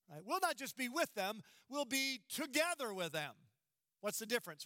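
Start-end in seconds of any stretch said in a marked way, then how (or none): 1.7-3.3 together leaned on, falling firmly; stress on together. Shown as a demonstration. Preacher stressing unity with the dead, emphatic together. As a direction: hit together hard. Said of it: "there's an emphasis".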